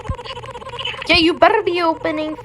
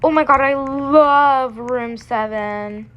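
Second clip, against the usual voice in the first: normal talking voice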